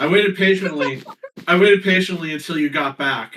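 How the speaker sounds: waited punched loud and falling; emphatic insistence. Real, not acted. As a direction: hit waited hard, insisting.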